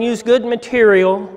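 ordinary delivery

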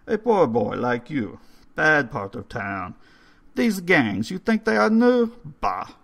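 mock the idea like an old Hungarian; scornful old man. Old man scoffs at gangs.

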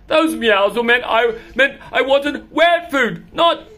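high, loud insistent complaint; high petulant cat demand. Acted. Cat demands wet food.

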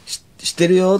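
high, loud teasing jab; playful tease. Retold or imitated. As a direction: tease her gleefully. Teasing Arii.

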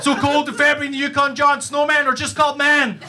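high, strained, urgent boasting; storming-in one-upper. Acted. Stranger storms into the winter conversation to outdo everyone, hammed-up boasting. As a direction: barge in high-pitched and insistent. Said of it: "will just come storming over".

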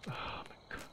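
whispered frightened oath; cursing under breath. Real, not acted. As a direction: breathe it out in fear. Frightened by game sound.

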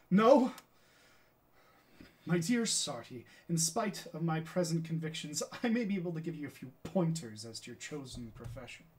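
emphatic theatrical opening, sharp loud first word; Galileo. Acted. Galileo answering his friend Sarti, theatrical and emphatic. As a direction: declaim it like a stage scientist. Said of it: "Galileo is saying".

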